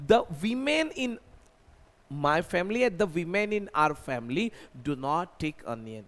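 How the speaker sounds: slow model sentence; slow dictation. Shown as a demonstration. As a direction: spell it out for learners. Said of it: "मैंने बोला कि sir". (धीमा उदाहरण)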